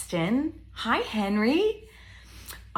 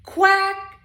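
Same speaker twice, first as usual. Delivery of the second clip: high, loud storybook quack; bright punchy animal call for kids; duck quack; 10 semitones higher, 7 dB louder than usual. Acted. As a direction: quack like a picture-book duck. Voicing the duck.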